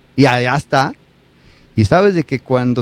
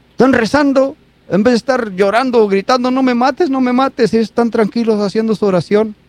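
higher, wondering voice of the onlooker; amazed onlooker; 6 semitones higher than usual. Retold or imitated. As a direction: marvel at their calm. (asombro del espectador, más agudo)

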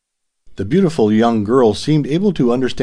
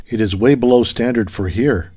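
plain reading voice

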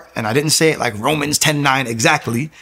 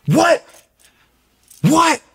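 high, loud shocked cry; 5 dB louder than usual. Retold or imitated. Retelling seeing Paul from the barbershop on stage, shocked. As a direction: react in high-pitched disbelief. Learns barber is worship leader.

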